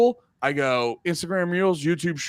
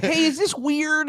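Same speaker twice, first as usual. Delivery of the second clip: high, slow put-on voice asking about a thumb; teasing a friend by acting out his weird-thumb question, high and slow; mock nerdy asker; 12 semitones higher, slower than usual. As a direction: ask it in a goofy high voice.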